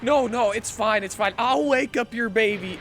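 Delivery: high, loud, hectic insistence; imitated manic reassurance. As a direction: frantically insist all is fine. Acting out manic fake doctor reassuring.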